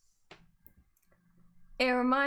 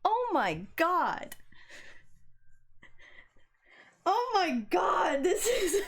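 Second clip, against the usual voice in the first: slow, drawn-out giddy cry; melting at the screen; real giddy squeal; overwhelmed squeal; 6 semitones higher, much slower than usual. Real, not acted. Overwhelmed by romantic scene.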